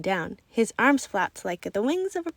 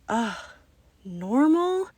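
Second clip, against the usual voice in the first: disappointed; much slower than usual. Acted.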